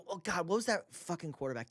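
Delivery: ordinary talk, nothing marked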